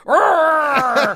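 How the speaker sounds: high loud rrr; loud growl. Shown as a demonstration. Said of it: "Here's one more".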